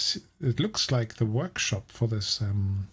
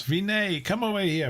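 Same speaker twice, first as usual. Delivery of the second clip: mock shout; 8 semitones higher than usual.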